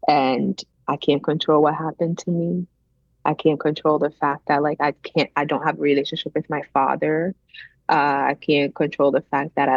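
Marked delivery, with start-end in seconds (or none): none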